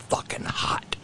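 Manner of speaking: breathy mouthed whisper. Retold or imitated. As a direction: mouth it silently, barely whispered. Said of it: "he mouthed the word to me".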